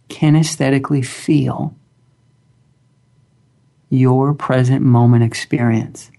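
slow, calm guided meditation voice; grounding exercise. Acted. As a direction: guide them gently into stillness, long pauses.

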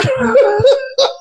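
high, breathy burst of laughter. Real, not acted. Speaker laughing at his own story. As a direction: laugh at the punchline.

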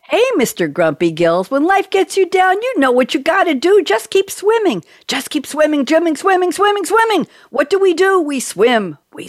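high singsong Dory voice, chanting swimming; Dory impression. Host quoting Dory to the guests, high and singsong. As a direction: cheer up a grumpy friend like Dory. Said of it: "quote from Dory, voiced by Ellen DeGeneres".

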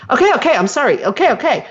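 high-pitched, brush-off "okay, okay"; mock a hurried, dismissive sorry; dismissive apology. Host mimicking someone dodging an upset partner, dismissive. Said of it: "avoid the topic and dismiss it".

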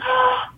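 high sharp mock gasp; imitated gasp. Friend hearing about the canceled hair appointment, gasp. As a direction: gasp in amazed recognition. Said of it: "And she said".

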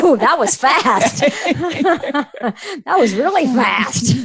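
laughing while talking, slow; words bubbling through laughter. Real, not acted. Amused at quick blackmail offer.

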